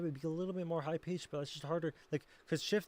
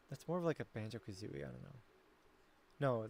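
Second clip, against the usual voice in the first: normal talking voice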